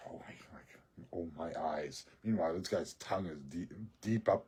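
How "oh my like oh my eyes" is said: near-whispered mock dismay; mock hushed reaction. Guy reacting to what he's shown, hushed mock dismay. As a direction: imitate him, soft and half-whispered. Imitating the guy's shocked reaction.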